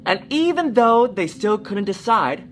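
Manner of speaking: put-on lively read-aloud for children; storybook reading. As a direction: tell it like a bedtime story. Story narration.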